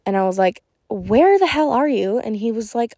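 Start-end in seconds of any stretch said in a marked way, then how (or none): 0.9-2.2 angry demand. Retold or imitated. Caught boyfriend cheating, on phone.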